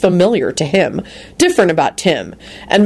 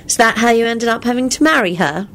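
teasing; 6 semitones higher than usual. A narrator's reading. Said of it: "Rosie replied teasingly".

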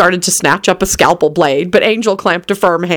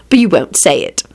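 ordinary delivery

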